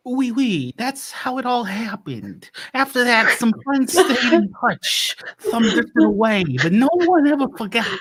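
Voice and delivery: high, theatrical French narrator; hammed-up French accent for a wistful epilogue; French-accented storyteller, slowing to a hush at the end. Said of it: "French accent".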